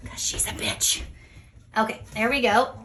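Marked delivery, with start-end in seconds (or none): 0.1-1.0 breathy whispered aside under her breath. Real, not acted. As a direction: drop to a whisper for the jab. Muttered aside about kicking goat.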